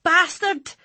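loud, high outburst; sudden shout. A narrator's reading. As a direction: blurt it out in shock. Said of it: "Peppa shouted".

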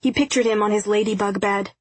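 usual speaking voice

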